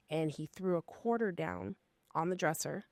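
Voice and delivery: ordinary talk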